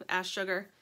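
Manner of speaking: usual speaking voice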